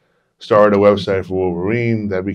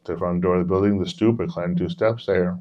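plain reading voice

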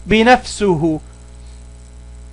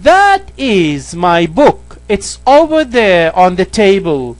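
slow, careful reading of the English example; much slower than usual. Shown as a demonstration. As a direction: read each word slowly for the learner. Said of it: "بنقول that". (قراءة بطيئة وواضحة للمثال)

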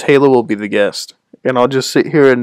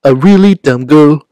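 raised, emphatic cartoon-character impression; Stewie; 5 semitones higher, 4 dB louder than usual. Streamer trying a friend's old impression, bad high cartoon voice. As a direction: badly imitate a cartoon voice, pushing each phrase higher.

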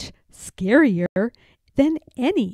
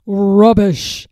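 louder, dismissive snort of a word; undaunted scoff; 7 dB louder than usual. A narrator's reading. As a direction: brush the fear aside. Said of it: "completely undaunted".

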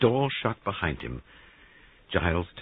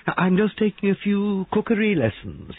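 high, loud, playful character voice; airy excuse; 5 dB louder than usual. Acted. Christopher caught in the kitchen by Giles, high and airy. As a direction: pipe up with an innocent excuse.

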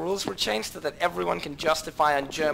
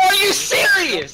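high, loud, drawn-out shout; incredulous yell; 6 dB louder, slower than usual. Real, not acted. Player to friend in the argument, incredulous yell. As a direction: yell it slowly in disbelief.

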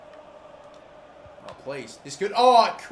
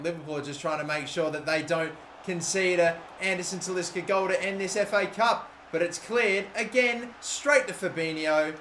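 ordinary delivery

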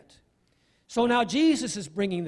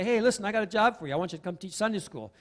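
quick pastor ask; much faster than usual. Retold or imitated. Pastor voiced making request.

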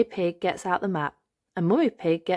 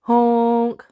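horn honk imitation; 5 semitones higher, 8 dB louder than usual. Tow truck horn arrives.

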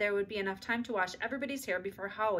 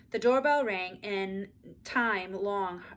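plain reading voice

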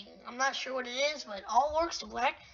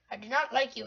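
ordinary delivery

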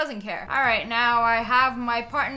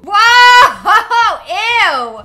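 loud yelp then laughing ew; disgusted yelp; 9 semitones higher, 18 dB louder than usual. Real, not acted. Player sees the dirty restroom, loud disgusted yelp. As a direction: recoil with a loud ew.

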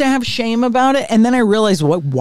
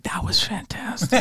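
mock-whispered sign-off, soft and voiceless; whispered aside; 8 dB quieter than usual. Comic retelling her exit after the mic drop, whispered. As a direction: whisper it as a dry exit line.

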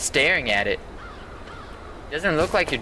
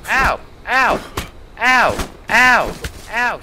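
sharp loud yelps of pain; pained ow cries; 7 semitones higher, 6 dB louder, much slower than usual. Real, not acted. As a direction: cry out each hit. Getting hit, crying out.

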